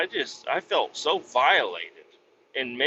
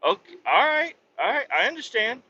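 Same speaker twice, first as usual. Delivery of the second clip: lightly mock the flustered boss, higher; 10 semitones higher than usual. Mocking his boss who brushes him off, in a high put-on voice. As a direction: boss voice, pitched up and fluttery. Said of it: "He's like".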